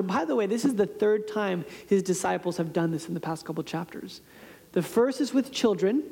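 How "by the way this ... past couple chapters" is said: normal talking voice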